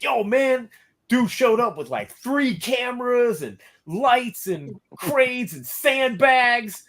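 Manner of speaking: high, slow, hyped-up buddy voice, pitched well up; imitating Kyle. Kyle telling him about the rival's big shoot, high and slow. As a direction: stretch it out like an amazed friend reporting gossip.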